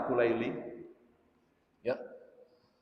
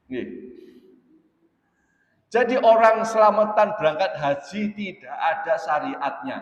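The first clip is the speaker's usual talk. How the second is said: usual speaking voice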